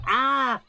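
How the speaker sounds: high, loud cry of alarm; startled "ah". Real, not acted. Player caught out in the game, startled yelp.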